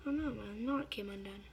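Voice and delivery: normal talking voice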